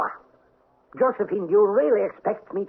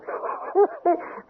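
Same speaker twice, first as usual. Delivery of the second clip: gloating laugh. Acted. Count gloats over his heir.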